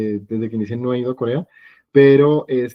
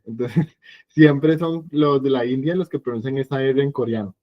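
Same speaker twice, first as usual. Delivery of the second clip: ordinary delivery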